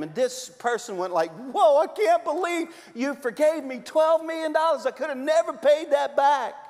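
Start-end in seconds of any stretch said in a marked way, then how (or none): 1.5-6.6 mock amazement. Forgiven debtor amazed.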